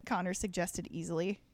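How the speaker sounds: normal talking voice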